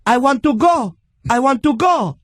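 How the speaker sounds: high-pitched mock Latin woman; mock Latin accent. Pushy older lady on a packed train, mock Latin accent. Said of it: "That's your Latin voice?".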